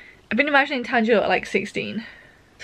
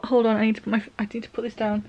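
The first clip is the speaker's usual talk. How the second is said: normal talking voice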